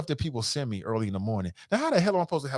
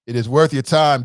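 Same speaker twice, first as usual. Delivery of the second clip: emphatic louder take; 8 dB louder than usual. Shown as a demonstration.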